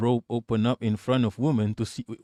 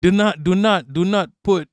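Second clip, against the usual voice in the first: NOT hit hard and louder, a little higher; emphatic warning; 5 semitones higher, 5 dB louder than usual. Real, not acted. Streamer warns his chat, stressing every not hard. Warning viewers off posting photos.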